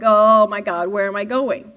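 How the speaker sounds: loud, breathless Oh my God; excited panic. Retold or imitated. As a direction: blurt it out like a nervous new driver. Anxious student driver, excited.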